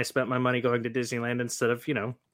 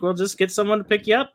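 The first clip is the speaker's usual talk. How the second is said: higher, quicker, lightly voiced policeman; imitating cop; 10 semitones higher, 5 dB louder, faster than usual. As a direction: toss off the cop's line, a bit higher. Cop voiced in retold story.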